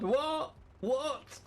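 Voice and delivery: high-pitched, playful "what?"; mock disbelief. Streamer caught teasing Yoda Phil, mock disbelief. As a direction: squeak it out in feigned shock.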